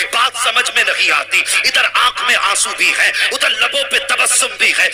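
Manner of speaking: impassioned preacher's crescendo; rising preaching climax. Acted. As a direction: build the fervour line by line to a ringing peak.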